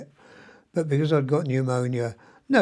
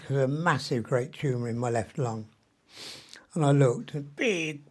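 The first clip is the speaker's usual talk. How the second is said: ordinary delivery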